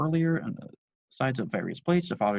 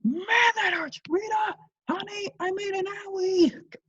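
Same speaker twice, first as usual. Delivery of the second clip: very high whiny shouting dad; cried out; 4 dB louder, slower than usual. Acted. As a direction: wail for sympathy like a child. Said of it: "chef cried out".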